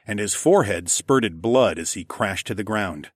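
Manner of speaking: ordinary delivery, nothing marked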